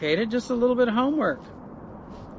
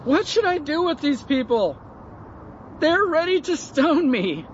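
crying out to God; 8 semitones higher than usual. A narrator's reading. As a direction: call up to God for help. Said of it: "Moses cried out to the Lord".

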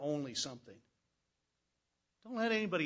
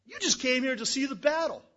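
accusing jab; 9 semitones higher, 10 dB louder than usual. Acted. Brothers accuse David.

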